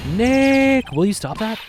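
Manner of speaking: high, loud, drawn-out name, then high scolding; exasperated scolding. Acted. Companion telling Nick to stop, exasperated scolding.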